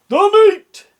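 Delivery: very high, very loud roar; rowdy crew roar. A narrator's reading. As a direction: roar it like a hungry pirate crew. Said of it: "we all roared".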